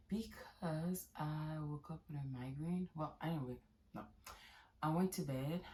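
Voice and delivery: sleepy, hushed voice; soft morning voice. Real, not acted. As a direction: talk softly, just woken up. Soft morning talk after migraine.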